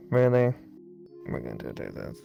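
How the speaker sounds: ordinary talk, nothing marked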